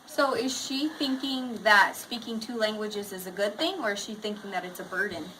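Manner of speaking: lively read-aloud voice for the class; reading to children. A narrator's reading. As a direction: read the picture book to students. Teacher reads story aloud.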